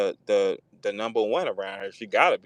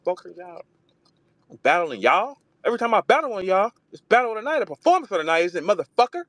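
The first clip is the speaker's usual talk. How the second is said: high, brash mock of Jazz barking back; high-pitched boastful Jazz impression; imitating Jazz; 6 semitones higher than usual. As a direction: taunt them like Jazz, pitched up and quick. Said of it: "barking back at y'all like".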